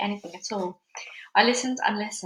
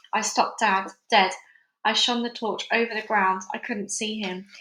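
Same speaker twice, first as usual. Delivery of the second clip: usual speaking voice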